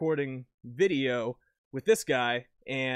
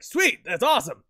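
excited; 8 semitones higher, 6 dB louder than usual. Retold or imitated. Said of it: "And I was like".

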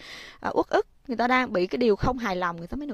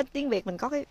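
usual speaking voice

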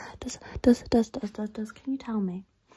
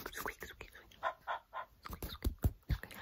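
soft mouth sound effect; whispered squeak noises; 4 dB quieter than usual. Acted.

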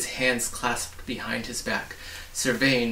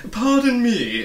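much higher, pompous protest; insisting humbug; 12 semitones higher, 8 dB louder, slower than usual. Acted. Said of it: "insisted the humbug".

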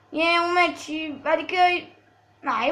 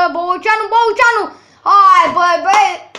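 high, loud shout at the player; urging shout; 5 semitones higher, 8 dB louder than usual. Real, not acted. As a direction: call him on, fed up.

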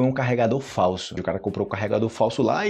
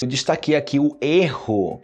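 normal talking voice

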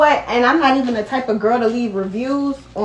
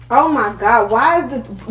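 ordinary delivery

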